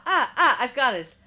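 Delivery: loud, laughing I've got it; excited eureka. Real, not acted. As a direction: burst out with the idea.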